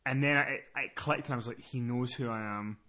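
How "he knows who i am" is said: low, soft, slow inner voice; hushed realisation. Retold or imitated. Caller realising he is recognised, hushed and slow. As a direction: murmur it slowly to yourself.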